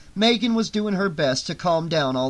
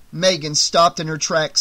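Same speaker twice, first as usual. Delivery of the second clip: ordinary delivery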